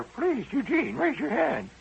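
high prim schoolteacher voice, calling the roll; stern teacher. Retold or imitated. Teacher calling on a first grader, high and prim.

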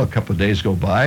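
usual speaking voice

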